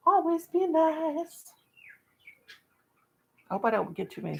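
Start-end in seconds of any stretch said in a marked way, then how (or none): 0.1-1.5 high, slow, sugary belle advice; Southern Belle voice. Acted. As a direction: coo it sweetly like a Southern lady.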